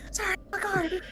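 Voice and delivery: small, high, soft voice; mimicking. Player mimicking the guard's apology, soft high voice. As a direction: echo it in a tiny high-pitched voice.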